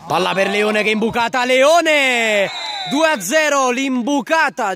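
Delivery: fast build to a high goal shout; goal call. Real, not acted. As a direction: shout the scorer's name. Goal scored.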